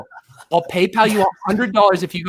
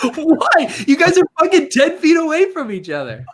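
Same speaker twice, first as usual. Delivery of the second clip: laughing; 7 semitones higher, 4 dB louder than usual. Real, not acted. Laughing while pressing friends.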